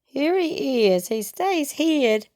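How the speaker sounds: normal talking voice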